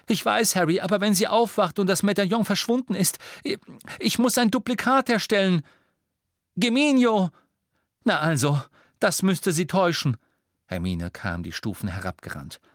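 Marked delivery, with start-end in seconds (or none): 0.1-10.2 high, loud, breathless hurry; hurried and tense. A narrator's reading. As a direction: rush the words under pressure. Hermione works in a hurry.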